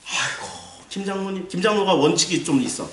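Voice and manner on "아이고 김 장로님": apologetic. Acted. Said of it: "엘리는 어떻게 목회를 했을까요".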